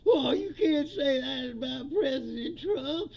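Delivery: whiny mocking voice, slow, with a laugh; mock his wounded loyalty, drawn out; mock offended host.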